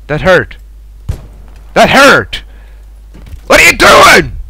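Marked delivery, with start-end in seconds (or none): 1.7-2.4 higher, louder protest at being hit; indignant outcry. Real, not acted. Repeats it more forcefully. 3.5-4.4 high, loud cry of disbelief; outraged shout. Real, not acted. Killed in the game.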